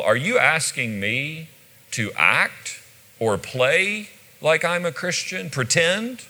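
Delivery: incredulous sarcasm, rising high at the end. Shown as a demonstration. Agrippa answers Paul's appeal, acted sarcastic disbelief. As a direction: question him as if the idea is absurd. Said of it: "even more sarcastic is this one".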